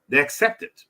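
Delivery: usual speaking voice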